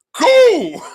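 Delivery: high laugh through the word. Real, not acted. Retelling the awkward setup to viewers, laughing. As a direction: crack up mid-word.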